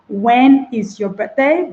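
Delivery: "When is your birthday?" slowed, each syllable apart. Shown as a demonstration. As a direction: read it out syllable by syllable.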